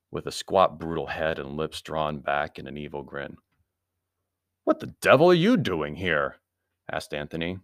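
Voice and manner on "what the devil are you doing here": startled demand with a loud punch; indignant challenge. A narrator's reading. Anthony to a stranger in his doorway, indignant.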